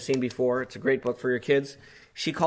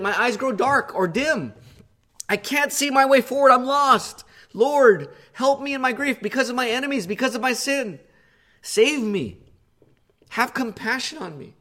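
high, loud, pleading psalm reading; impassioned preaching; 10 semitones higher, 5 dB louder than usual. Acted. Preacher voicing David's lament to the congregation, high and pleading. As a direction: cry out David's plea with rising urgency.

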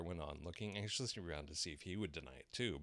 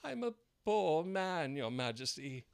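high, slow pleading man; pleading Hatter; 10 semitones higher, 8 dB louder, slower than usual. A narrator's reading. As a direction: beg for mercy on one knee. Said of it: "he began".

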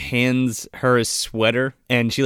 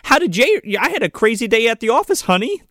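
high, sing-song mock rambling; hammed-up high chatter to a partner; frazzled recap; 9 semitones higher than usual. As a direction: gabble the day's story in a pitched-up voice. Acted recap of crazy day.

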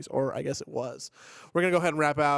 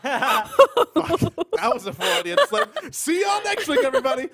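loud, high laughing blurt; laughing outburst; 13 semitones higher, 10 dB louder than usual. Real, not acted. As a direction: laugh off the flub loudly. Flubs line, laughs it off.

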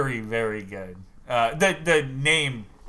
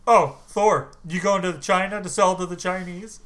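mock surprised greeting; 6 semitones higher, 5 dB louder than usual. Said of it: "He's like".

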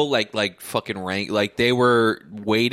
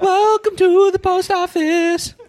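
loud, slow, very high crooning; mock falsetto; 6 dB louder, slower than usual. Post office clerk welcoming the singer, loud falsetto spoof. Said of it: "he has to talk in that voice".

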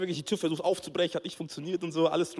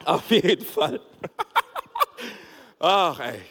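laughing; 3 semitones higher, slower than usual. Real, not acted. Laughing after story.

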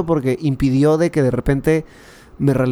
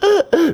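high-pitched mock sobbing gasps; imitated sobbing gasp. Retelling crying over spilt juice, mock sobbing gasps. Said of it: "lloraba sollozando y decía".

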